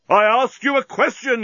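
high, insistent yell. A narrator's reading. Bolfas yelling at the parrot, high angry shout. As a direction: yell it in exasperation. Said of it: "yelled Bolfas".

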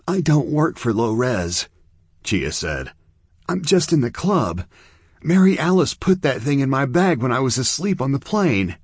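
put-on a scared girl pleading her innocence; teenage girl.

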